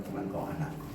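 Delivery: hushed, soft, as God speaking gently; whispered tender God. Retold or imitated. God reassuring the pastor, soft hushed voice. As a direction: breathe it like a loving father.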